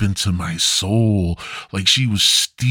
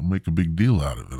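ordinary talk

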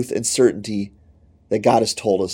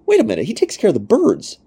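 sudden realization; 9 semitones higher than usual. Acted. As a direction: snap awake to the truth. Inner voice catching itself.

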